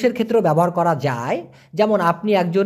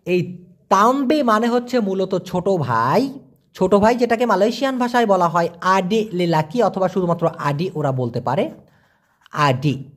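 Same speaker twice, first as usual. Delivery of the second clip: normal talking voice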